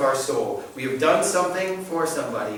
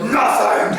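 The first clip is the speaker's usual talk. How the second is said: higher, louder fake-angry "Nothing!"; mock shout; 9 semitones higher, 7 dB louder than usual. Sulking husband snaps at his wife, mock shout.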